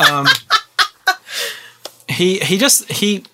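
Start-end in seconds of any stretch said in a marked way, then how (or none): none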